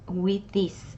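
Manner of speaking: ordinary talk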